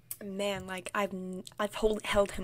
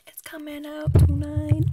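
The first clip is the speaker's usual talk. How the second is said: excited whisper-shout; 6 semitones higher, 12 dB louder than usual. Real, not acted. Drunk confession, sudden emphasis.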